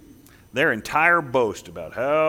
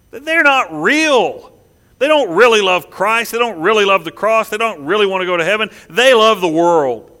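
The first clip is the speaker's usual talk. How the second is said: fervent preaching; 3 semitones higher, 5 dB louder than usual. Real, not acted. Preaching at full force.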